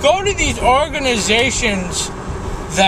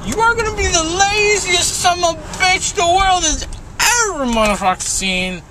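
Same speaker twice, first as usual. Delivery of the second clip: squeaky, much higher mocking voice; mocking official voice; 10 semitones higher than usual. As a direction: sneer it like a smug official.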